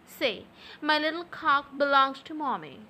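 usual speaking voice

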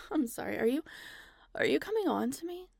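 normal talking voice

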